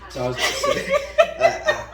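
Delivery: words lost in a high laugh; cracking up mid-sentence. Real, not acted. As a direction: try to start but burst out laughing. Laughing too hard to talk.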